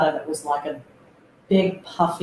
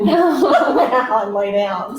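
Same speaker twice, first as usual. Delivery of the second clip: higher, louder, laughing through the words; 8 semitones higher, 7 dB louder, much slower than usual. Real, not acted. Woman recalling being told to leave the bucket, laughing. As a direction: let the laugh break into the line.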